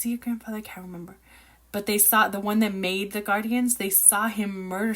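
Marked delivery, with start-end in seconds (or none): 0.6-1.2 whispered aside. Real, not acted. Aside while unsure.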